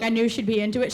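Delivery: ordinary talk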